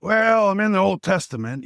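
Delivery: put-on very high, raspy old Italian man; Pepe's raspy Italian voice. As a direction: push the imitated grandfather's Italian rasp way up in pitch. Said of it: "He's got this Italian raspy voice".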